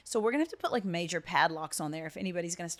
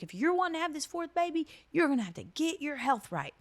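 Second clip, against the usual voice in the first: a raised, higher voice imitating her friend; friend Amy's voice; 8 semitones higher than usual. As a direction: lightly voice the friend quoting her, pitched up. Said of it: "she was like".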